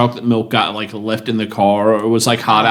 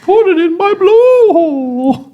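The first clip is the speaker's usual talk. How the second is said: very high, loud, stretched-out whale moan; 5 dB louder, much slower than usual. Acted. Joking as the whale furry, hammed-up whale voice. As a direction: wail it slowly like whale song. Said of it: "in a more whale voice".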